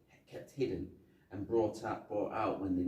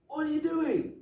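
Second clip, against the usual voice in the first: very high, loud mock panic; alarmed outcry; 6 dB louder than usual. Acting Murtaugh as Riggs drives on the pavement, alarmed. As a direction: yelp it in comic alarm.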